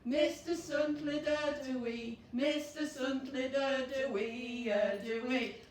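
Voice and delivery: loud slow chant with shouted hoorays; joyful hooray song; cheerful cheer. Acted. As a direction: cheer it out big for the kids. Cheerful finale verse.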